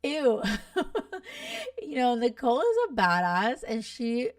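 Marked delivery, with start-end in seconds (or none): 0.0-0.4 mock disgust. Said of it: "I was like". 0.5-1.8 a squealing laugh, much higher. Real, not acted. Laughs at Louis Vuitton question.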